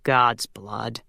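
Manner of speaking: low, reluctant groan of a curse; groaned oath. A narrator's reading. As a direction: groan it with dread, pitched low. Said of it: "closed his eyes and groaned".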